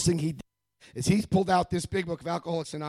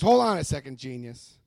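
loud opening, dropping on genius; condescending; 3 semitones lower, 7 dB louder than usual. Retold or imitated. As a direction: talk down to a know-it-all, imitating him. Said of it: "A very condescending".

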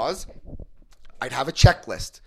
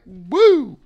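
high loud woo; tipsy whoop; 9 semitones higher, 11 dB louder than usual. Retold or imitated. Telling the meeting how poison feels, mock tipsy whoop. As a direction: whoop like the drink just hit. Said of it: "It makes you like".

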